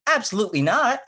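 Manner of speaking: high, giggly mock-emphatic denial; weird over-denial. Driver denying to cop she's under the influence, weirdly high and giggly. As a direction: pitch it way up, barely holding the laugh. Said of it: "I'm being the weirdest".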